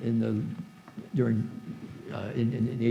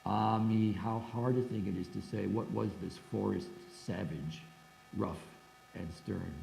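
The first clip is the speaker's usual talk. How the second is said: reading the translation; 3 semitones lower than usual. Shown as a demonstration. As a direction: read the verse aloud to the class. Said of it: "here's how he translated".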